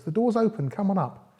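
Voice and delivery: high granny calling down; high-voiced granny inviting someone in; grandmother voice. A narrator's reading. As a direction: pitch it up as the grandmother. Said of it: "The grandmother says".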